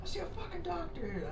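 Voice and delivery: high, shouted order; angry yell. Retold or imitated. Recruits yelling at their sick bunkmate to see a doctor, high yell.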